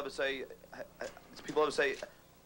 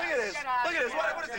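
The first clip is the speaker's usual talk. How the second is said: outraged shouting; 8 semitones higher, faster than usual. Real, not acted. Outraged during scuffle.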